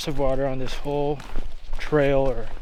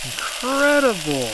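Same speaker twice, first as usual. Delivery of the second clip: amazed; 10 semitones higher, 4 dB louder, slower than usual. Real, not acted. Amazed at sizzling lamb chops.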